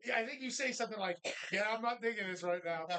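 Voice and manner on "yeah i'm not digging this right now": ordinary talk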